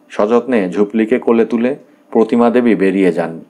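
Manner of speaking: ordinary delivery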